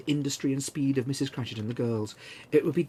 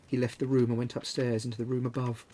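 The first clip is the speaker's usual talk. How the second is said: usual speaking voice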